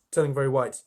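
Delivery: usual speaking voice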